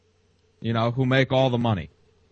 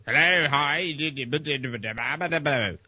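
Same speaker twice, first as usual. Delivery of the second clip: garbled speech mockery; 3 dB quieter than usual. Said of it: "the computer can't understand".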